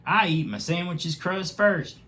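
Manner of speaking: the badger bravely speaking up first; Pumpernickel the badger. A narrator's reading. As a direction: chime in brightly. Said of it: "he said".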